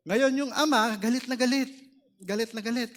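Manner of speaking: high, loud emphatic preaching; rising emphasis. Acted. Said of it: "preacher describes angry father". (mataas at malakas na pagdidiin)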